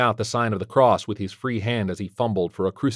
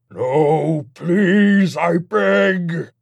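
high, loud demonic pleading; wailed, desperate demon plea; begging demon; 9 semitones higher, 6 dB louder, much slower than usual. Acted. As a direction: beg desperately as a beaten demon. Demon begs to be spared.

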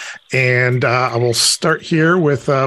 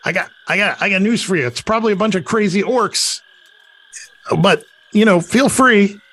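scoffing kobold; 6 semitones higher, faster than usual. Acted. GM voices kobold's scoffing reply.

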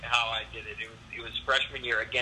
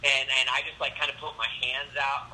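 ordinary talk

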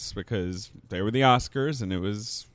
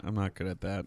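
ordinary talk, nothing marked

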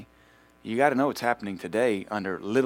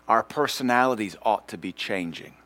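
ordinary delivery

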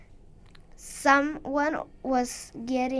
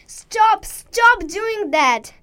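loud, higher, slow angry shout; screaming; 7 semitones higher, 8 dB louder, much slower than usual. Acted. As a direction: yell at the voice to quit. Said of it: "Wirla screamed to the weird voice".

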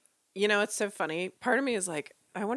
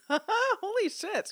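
laughing; 11 semitones higher, much slower than usual. Real, not acted. Reacting to the story's ending.

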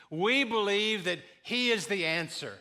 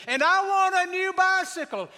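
put-on much higher, loud boy's shout; shouting child; 13 semitones higher, 8 dB louder than usual. Boy shouting his Christmas prayer so Grandma hears. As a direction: yell the wish to the heavens. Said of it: "he started shouting".

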